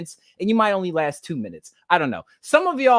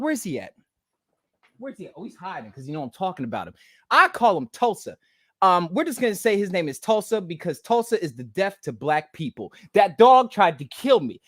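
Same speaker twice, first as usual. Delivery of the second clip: normal talking voice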